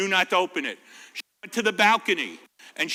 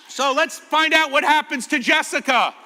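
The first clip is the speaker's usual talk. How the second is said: higher, brighter storybook read; reading to children; 6 semitones higher, 5 dB louder than usual. Acted. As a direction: launch the story for the kids.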